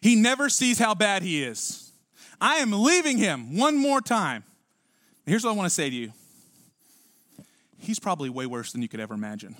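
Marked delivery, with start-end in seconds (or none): 0.0-4.4 mock marital tirade, rising and drawn out at the end; fed-up spouse. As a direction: vent like a spouse at the end of it, slowing on the threat. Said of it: "It looks something like this".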